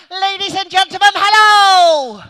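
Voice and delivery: high, very loud crowd welcome; booming show greeting. Real, not acted. Magician opens his show to the audience, booming greeting. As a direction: belt out the hello, stretching it long.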